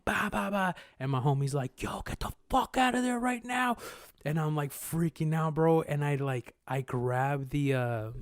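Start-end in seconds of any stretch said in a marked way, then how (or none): 0.1-0.8 high, blaring alarm noise; high-pitched mock alarm beeps; imitated alarm. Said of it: "So alarm's like". 1.8-3.8 high, urgent retold shout; urgent mock yell. Friend urgently calls him out.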